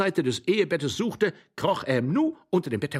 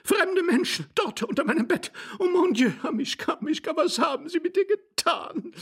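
very high, wailing female voice; high, frantic lady's cries; hysterical lady. A narrator's reading. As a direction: lament in horror, pitched right up.

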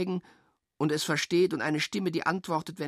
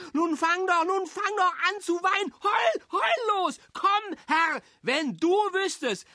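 taunting shout; 5 dB louder than usual. Acted. Jeering a crying child, screaming high and loud. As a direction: scream at them to go ahead and cry.

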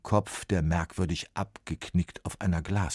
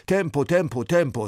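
louder, higher hurry call; urging; 10 semitones higher, 8 dB louder than usual. A narrator's reading. Wigged man hurries the copyists, loud. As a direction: drive them on, clap clap. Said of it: "sagt er".

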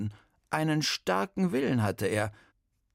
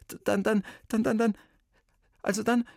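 stammering; 10 semitones higher, faster than usual. Acted. Boy stammers in panic.

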